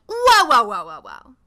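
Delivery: imitating the girls' startled protest; mock alarmed yell. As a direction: halt them with a hammed-up alarm. Girls stopping the boys.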